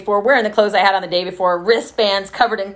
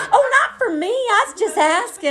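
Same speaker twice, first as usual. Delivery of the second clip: very high, squeaky mock protest; defensive mimicry; 3 dB louder than usual. Woman backing off from a sponsor's step, high mock protest. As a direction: mimic her in a high, loud-edged squeal.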